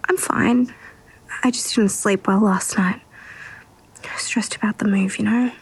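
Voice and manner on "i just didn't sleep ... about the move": whispering. Acted. Whispers throughout.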